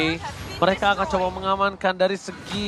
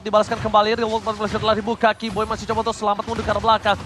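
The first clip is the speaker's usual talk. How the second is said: ordinary talk, nothing marked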